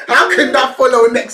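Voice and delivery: loud laughing question; laughing disbelief. Real, not acted. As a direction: ask it through a laugh.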